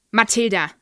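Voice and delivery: furious bark of a name; loud, angry bark at a pupil. A narrator's reading. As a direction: bark the name like a brutal headmistress. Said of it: "bellte sie".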